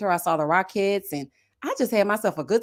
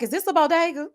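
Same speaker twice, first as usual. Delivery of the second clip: eager tourist question; 6 semitones higher than usual. Retold or imitated. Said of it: "I was like".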